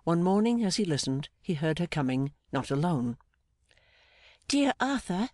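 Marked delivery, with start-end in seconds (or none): 4.5-5.3 high-pitched delighted woman's voice. A narrator's reading. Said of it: "said her delighted voice".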